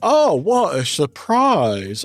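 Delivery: mock surprise. Streamer mocking a chatter who keeps eating dice, hammy surprise. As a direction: act fake dismay at eating dice again.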